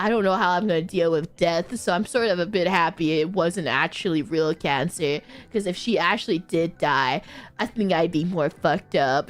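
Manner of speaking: low, subdued friend's voice; retold resignation, pitched low; subdued admission. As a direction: drop the pitch for a sober confession. Voicing friend's sombre admission.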